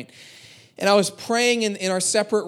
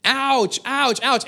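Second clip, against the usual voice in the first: higher imitated kids' "ouch"; mock cries of pain; 5 semitones higher, much slower than usual. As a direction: mimic squabbling kids crying out. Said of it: "when there's shouting matches like that".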